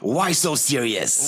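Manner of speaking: slow, drawn-out quote in a put-on villain voice; movie villain quote. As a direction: tease them with the famous line, slowly. Film quote tossed into banter.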